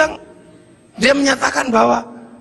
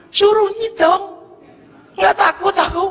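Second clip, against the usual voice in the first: much higher defiant retort; 11 semitones higher than usual. Acted. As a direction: toss it off fearlessly. Villager defying count of sins.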